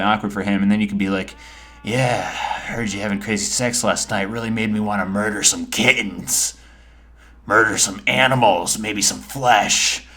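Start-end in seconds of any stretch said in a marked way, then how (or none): 1.8-10.1 mock-creepy serial killer kid; budding serial killer. Child at breakfast freaking out dad, creepy killer act. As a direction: act disturbed to unsettle your parents, drawing out the last words. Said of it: "insinuate to your parents that you're becoming a serial killer".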